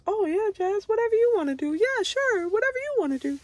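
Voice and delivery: high, sing-song mock agreeable voice; mock pushover friend. To people in her life, high mock yes-man voice. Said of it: "I'm not saying I want people to just".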